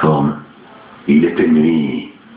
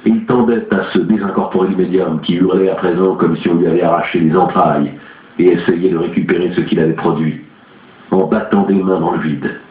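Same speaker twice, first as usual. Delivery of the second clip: plain reading voice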